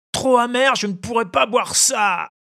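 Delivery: high, shouted complaint; loud whining protest. A narrator's reading. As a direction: cry out that it's too bitter. (protestation criée d'enfant)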